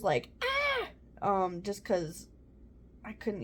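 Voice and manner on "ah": short, very high mock screech; imitated high screech. Mother imitating her screeches during labor, high mock screech. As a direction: let out a brief high-pitched "ah". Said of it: "a couple of high screeches".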